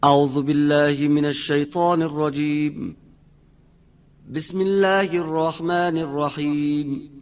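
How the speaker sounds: high, slow melodic chanting of verses; Quran recitation. Acted. Teacher recites the surah for students to learn. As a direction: intone each verse in tadwir style.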